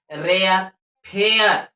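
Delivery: रेयर and फेयर with an e-uh glide; रेयर, फेयर, emphatic teacher demo; model words. Shown as a demonstration. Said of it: "ए का उच्चारण यहाँ पर ए अ हो रहा है".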